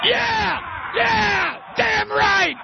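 high, hollered cheer; whooping cheer. Real, not acted. Host cheering on Dean's speech, exuberant. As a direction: holler along at the top of your range.

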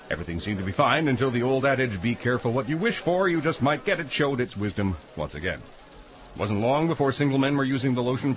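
grave narrator read; mock serious newsreel voice; documentary narration.

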